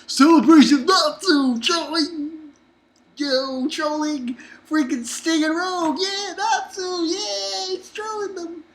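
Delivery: high, gleeful rant about trolling; excited. Real, not acted.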